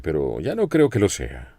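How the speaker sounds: usual speaking voice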